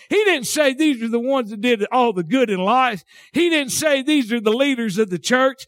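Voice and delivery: fervent, high-pitched revival preaching; preaching at full force. Real, not acted. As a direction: pitch climbing into a shouted sermon.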